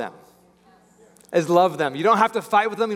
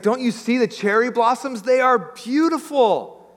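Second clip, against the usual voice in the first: admiring dad; 8 semitones higher than usual. Retold or imitated. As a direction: play a dad marvelling at the blossoms. Dad admiring blossoms in retold story.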